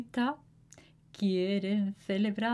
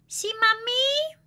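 put-on girl voice; 13 semitones higher than usual. A narrator's reading. Girl answers her mother.

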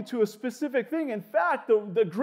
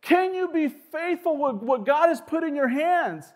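ordinary delivery

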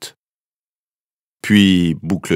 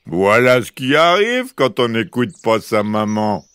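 teasing big-bear voice; mocking big bear; 3 semitones higher, 3 dB louder than usual. A narrator's reading. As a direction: jeer as the good-natured big bear. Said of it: "se moqua-t-il de sa grande voix". (grosse voix moqueuse d'ours)

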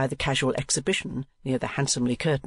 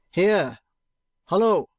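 loud, higher cry for attention; raised-voice hail; calling out; 5 semitones higher, 5 dB louder, slower than usual. A narrator's reading. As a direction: call out sharply to someone out of sight. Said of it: "cried Ralph".

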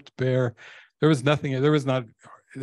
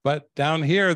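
ordinary talk

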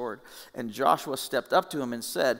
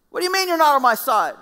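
much higher, louder shocked cry; shocked shout; 11 dB louder, faster than usual. Retold or imitated. The preacher imagines his own outraged question to God, shouting. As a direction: yell it in disbelief. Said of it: "My response would have been".

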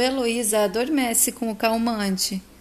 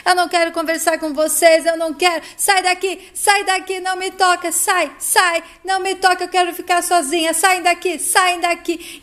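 higher-pitched, insistent acted yelling; agitated shouting; 6 semitones higher, 3 dB louder than usual. A narrator's reading. Heloísa orders psychiatrist and nurses out, agitated shouting. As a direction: order them out in rising agitation. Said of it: "cada vez mais agitada e grita".

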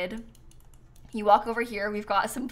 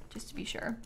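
unvoiced whispered aside; 13 dB quieter than usual. Real, not acted. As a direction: breathe it out as a quick private aside. Quiet aside to chat.

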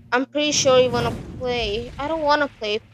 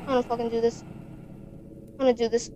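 ordinary talk

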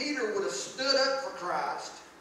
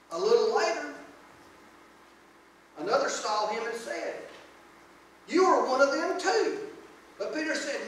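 scripture reading; 4 dB louder than usual. Acted. Reads the scripture passage aloud.

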